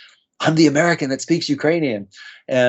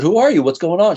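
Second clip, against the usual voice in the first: sharply higher and louder, caught off guard; taken aback by a weeping stranger, higher and louder; startled question; 7 semitones higher, 4 dB louder than usual. Retold or imitated. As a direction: blurt it out in surprise. Stranger hugging him, weeping.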